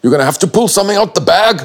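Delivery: retold high-pitched urgent shout; shouting coach. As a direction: yell it like a coach urging a swimmer on. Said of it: "was shouting at me".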